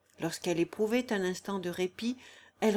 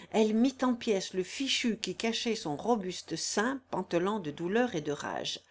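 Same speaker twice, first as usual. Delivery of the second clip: ordinary delivery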